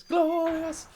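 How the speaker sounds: GLORIOUS sung out high and loud; hammed-up bellow. Streamer hyping his own stream, hammed-up high bellow. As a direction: belt out a drawn-out, over-the-top "glorious".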